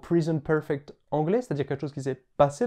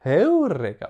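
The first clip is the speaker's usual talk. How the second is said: "Heureka" with the voice gliding up on "eu", then dropping; accent de hauteur; 4 semitones higher, 5 dB louder, slower than usual. Shown as a demonstration. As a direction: sing the pitch up a fifth, then down on "Heureka". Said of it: "La voix monte, puis redescend". (« Heureka » la voix monte puis redescend)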